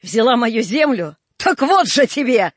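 slow, loud, venomous; vicious threat. A narrator's reading. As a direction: spit out the revenge. Aksinya's revenge before scalding.

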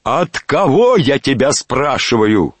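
high, loud, drawn-out yell; furious high-pitched demand; wild shout. A narrator's reading. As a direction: roar the question at the servant in a wild voice. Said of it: "крикнул Иван Александрович таким диким голосом".

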